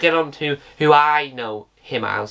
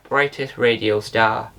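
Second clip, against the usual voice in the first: ordinary delivery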